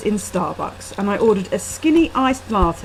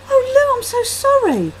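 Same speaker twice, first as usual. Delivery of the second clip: tearful apology; 6 dB louder than usual. A narrator's reading. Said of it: "She had tears in her eyes".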